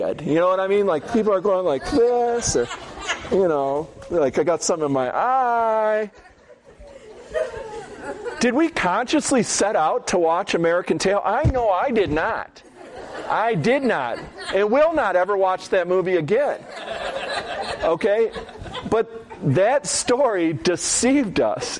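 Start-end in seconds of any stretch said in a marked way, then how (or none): 4.4-6.1 mock excuse. Grown men hiding tears at movie. 11.2-21.8 laughing. Real, not acted. Laughing at own denial.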